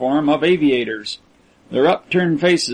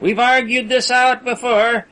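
higher, pleading prisoner voice; lightly voiced tall aviator, urgent; 6 semitones higher than usual. A narrator's reading. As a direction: press the captor to feel for them.

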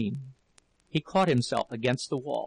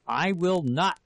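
mildly raised, higher angry refusal; furious shout; 5 semitones higher, slower than usual. A narrator's reading. Husband refusing his clinging wife, moderately furious shout. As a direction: refuse with restrained fury. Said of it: "he shouted in fury".

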